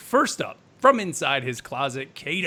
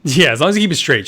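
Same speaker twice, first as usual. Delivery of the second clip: teasing; 10 dB louder, faster than usual. Real, not acted. Teasing friend about nose blowing.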